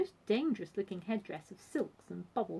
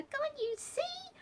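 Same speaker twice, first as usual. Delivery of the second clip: very high, loud, breathless revelation; gasping; 7 dB louder than usual. A narrator's reading. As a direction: gasp it out in a high squeal. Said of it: "she gasped".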